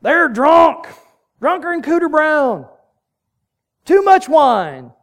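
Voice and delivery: loud, high-pitched hillbilly jeer; hammed-up hillbilly calling out the drunks. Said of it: "I did that in my best hillbilly voice".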